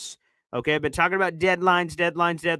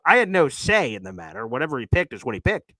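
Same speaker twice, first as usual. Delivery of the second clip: normal talking voice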